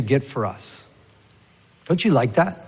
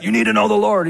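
raised, higher-pitched imitation of getting mad; mock angry preaching; 7 semitones higher, 4 dB louder, faster than usual. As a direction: scold them as if losing your temper. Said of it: "you start getting mad".

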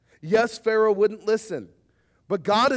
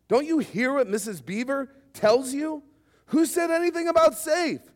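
indignant beaver; 8 semitones higher than usual. Acted. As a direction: scoff at the very idea of safe, pitch climbing. Mister Beaver's indignant retort.

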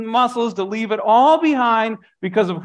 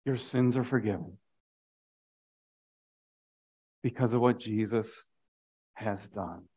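quiet assurance; 9 semitones lower, 12 dB quieter than usual. Real, not acted. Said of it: "I want you to know".

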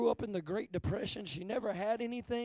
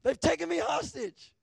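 mock yell, higher and louder with a laugh; a hammed-up cry for help from granny; yelling out the window; 7 semitones higher, 6 dB louder than usual. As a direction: call out to strangers in playful alarm. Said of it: "my granny yelled out the window".

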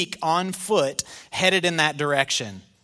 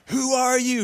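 clueless Saul; 8 semitones higher than usual. Acted. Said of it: "Saul is just like".

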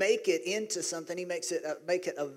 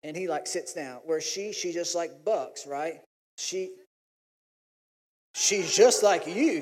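ordinary talk, nothing marked

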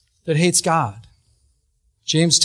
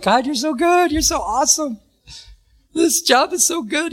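higher, beaming praise to God, slowing at the end; joyful praise; 7 semitones higher than usual. Retold or imitated. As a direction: retell the overflowing joy, lifted up. Joyful praise floating from car.

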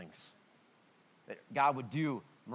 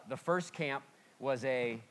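ordinary talk

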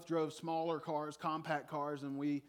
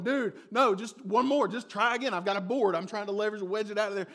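higher and louder, pushing hard through the effort; frantic urging; 5 semitones higher, 7 dB louder than usual. Retold or imitated. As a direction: yell encouragement while shoving a stuck truck. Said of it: "And I'm going".

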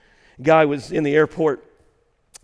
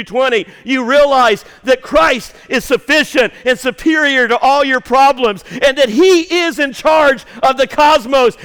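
growing louder and higher to a shouted peak; building sermon preaching; 6 semitones higher, 9 dB louder than usual. Acted. Pastor urging the congregation to praise, building to a shout. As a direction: build from teaching to a shouted climax.

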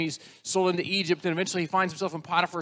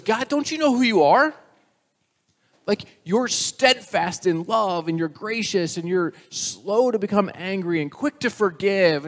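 pleading Moses; 6 semitones higher, 6 dB louder than usual. Acted.

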